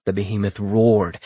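ordinary delivery, nothing marked

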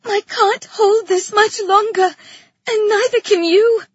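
put-on high, loud, strained girl's plea; tearful cry. A narrator's reading. Girl tells Asposia neither can hold on, tearful cry. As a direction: plead loudly, fighting back tears. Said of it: "the girl cried, sniffling back tears".

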